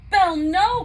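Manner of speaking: loud, high cry of alarm; cried out. A narrator's reading. Maurice crying out to stop his daughter, loud and high. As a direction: shout to stop her. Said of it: "cried Maurice".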